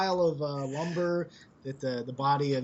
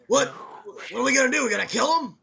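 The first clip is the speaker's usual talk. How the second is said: higher, louder panicky sidekick; nervous companion Bo; 10 semitones higher, 4 dB louder than usual. Acted. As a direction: blurt it out like a jittery friend. Bo voiced by game master.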